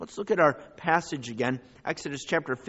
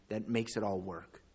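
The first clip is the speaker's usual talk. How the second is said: ordinary talk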